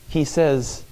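ordinary talk, nothing marked

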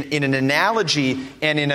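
normal talking voice

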